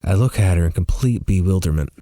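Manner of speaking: ordinary delivery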